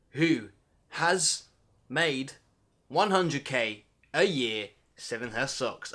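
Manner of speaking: each word separated by pauses, then slow; dramatic slow pauses. Acted. Building suspense about the sock woman, slow and pausing. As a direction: drag it out word by word. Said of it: "I don't know why I said it like that".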